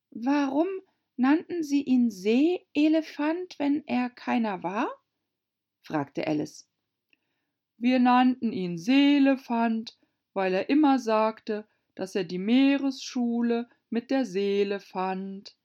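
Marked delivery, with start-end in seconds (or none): 0.1-4.9 put-on high, questioning girlish voice; puzzled young Alice. 7.8-15.6 loud, raised, irritated Mock Turtle; annoyed. A narrator's reading. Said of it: "verärgert".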